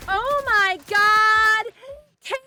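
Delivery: high shrieking disbelief; screaming with delighted shock; dawning joy. Real, not acted. Surprise raid arrives.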